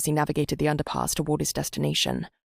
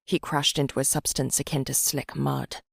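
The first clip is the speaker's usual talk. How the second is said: plain reading voice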